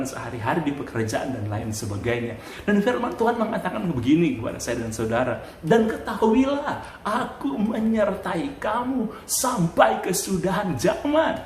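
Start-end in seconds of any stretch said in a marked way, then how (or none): 5.6-11.4 slow, high-pitched scripture proclamation; declamación solemne. Acted. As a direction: proclaim the promise, stretching it out high. Preacher declaims a Bible promise.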